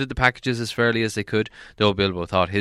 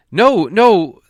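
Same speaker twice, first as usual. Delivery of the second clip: Bilbo's voice; 10 semitones higher, 11 dB louder than usual. A narrator's reading. Bilbo protests.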